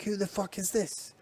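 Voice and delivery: high-pitched mock British accent. Podcaster imitating a wrestler's outburst, British accent. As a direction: put on a high British voice for the quote. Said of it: "I gotta do my British accent".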